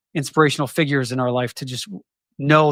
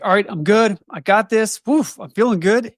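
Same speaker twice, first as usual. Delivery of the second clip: bright, high, confident pep talk to yourself; cheerful I-got-this voice; pumped-up self-talk; 7 semitones higher than usual. Retold or imitated. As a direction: psych yourself up, riding high. Upbeat self-talk on good days.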